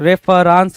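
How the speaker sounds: रेफरेंस with long "raans" ending; misspelling read out. Shown as a demonstration. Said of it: "रेफरेंस तो कुछ नहीं होना चाहिए".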